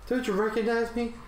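high-pitched put-on question; game character voice. Player voicing a Pokemon asking to be recognised, high put-on voice.

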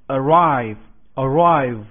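arrive said slowly and loudly, stress on RIVE; slow pronunciation demo. Shown as a demonstration. Teacher models arrive for the class, slow and loud. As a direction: stretch out arrive, hit the second syllable. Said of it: "动词表示到达的意思".

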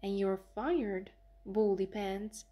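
ordinary delivery

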